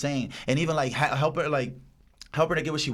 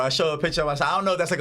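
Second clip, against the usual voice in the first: ordinary delivery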